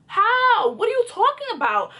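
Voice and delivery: high-pitched incredulous comeback; indignant protest. Retold or imitated. Girlfriend challenging boyfriend's accusation, indignant. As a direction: act out being baffled by the accusation.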